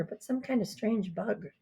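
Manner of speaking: ordinary delivery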